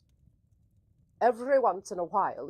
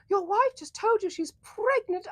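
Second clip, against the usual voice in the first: high voice; 11 semitones higher, 4 dB louder than usual. Acted. Reading chat message in raised voice.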